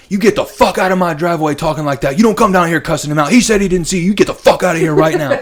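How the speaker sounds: loud, fast mock dealer chewing him out; angry yelling. Dealer chasing the biker from his driveway, retold angry yell.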